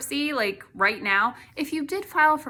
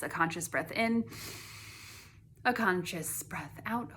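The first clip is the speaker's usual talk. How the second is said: guided breathing; 7 dB quieter than usual. Shown as a demonstration. Guiding viewers through a conscious breath, softly. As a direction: ease into the breath gently. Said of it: "take in a couple conscious breaths".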